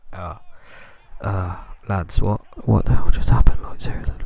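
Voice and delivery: disbelieving whisper; stunned whisper. Real, not acted. Gamer stunned after his defender's blunder, whispered disbelief. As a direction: breathe it out in shock. Shocked at conceding.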